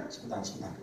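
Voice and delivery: hushed, voiceless hold-on; whispered stalling. Retold or imitated. A nervous believer stalls before battle in a whisper.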